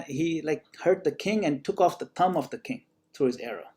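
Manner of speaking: ordinary talk, nothing marked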